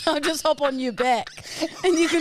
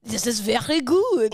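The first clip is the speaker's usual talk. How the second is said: mock French accent with throaty r. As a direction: savour it in put-on French. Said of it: "you're good at that".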